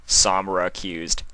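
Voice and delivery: usual speaking voice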